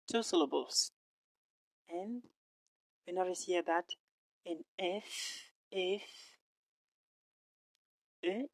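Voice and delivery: usual speaking voice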